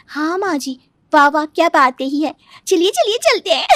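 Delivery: delighted urging to go; gleeful daughter-in-law. Acted. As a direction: cheer her on like it's a party. Daughter-in-law cheers mother-in-law on.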